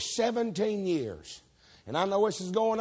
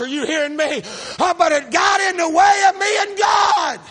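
higher and louder, shouting the point home; preaching at full force; 8 semitones higher, 6 dB louder than usual. Real, not acted. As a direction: preach it to the back row.

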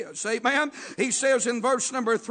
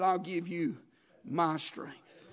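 softer, lower promise from God; tender promise; 6 semitones lower, 8 dB quieter, slower than usual. Retold or imitated. Preacher to congregation, voicing God's promise softly. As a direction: hush it down like a gentle vow.

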